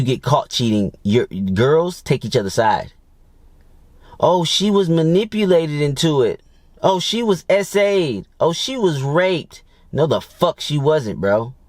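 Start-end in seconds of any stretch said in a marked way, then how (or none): 4.2-9.6 high, slow mocking girlfriend voice; mock girlfriends defending her. Mimicking her girls making excuses for her cheating, high and slow. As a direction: drag it out like a gossiping friend.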